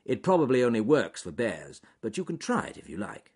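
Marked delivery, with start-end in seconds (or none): none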